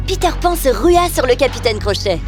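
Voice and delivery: plain reading voice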